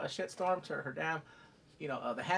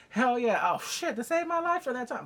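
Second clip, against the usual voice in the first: mock eagerness; 8 semitones higher, 6 dB louder than usual. Acting a dissembling knight.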